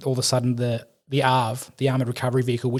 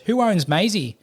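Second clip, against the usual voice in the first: sergeant major calling out, much higher; 8 semitones higher, slower than usual. Retold or imitated. Sergeant major calls to the soldiers, mock-calling out. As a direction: sing it out from the hatch as the sergeant major.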